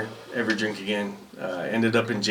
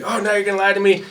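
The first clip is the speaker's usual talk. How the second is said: drill sergeant voice; 9 semitones higher than usual. Retold or imitated. Other battery's drill sergeant questioning a recruit, high mock bark. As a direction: grill him like a drill sergeant.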